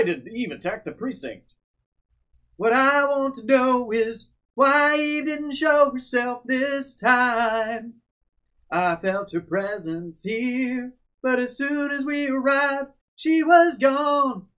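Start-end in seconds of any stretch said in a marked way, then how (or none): 2.6-14.5 slow, dramatic character reading; game character voice. Acted. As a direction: read the character's lines with gravity. Voicing game character lines.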